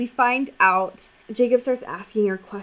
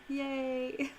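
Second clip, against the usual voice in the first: deadpan sarcastic yay; 5 semitones higher, 14 dB quieter than usual. Real, not acted. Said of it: "sarcastic cheer".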